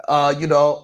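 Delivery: ordinary talk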